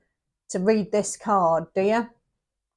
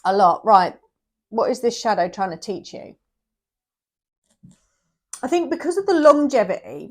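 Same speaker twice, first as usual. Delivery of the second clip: ordinary delivery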